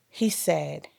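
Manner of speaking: ordinary talk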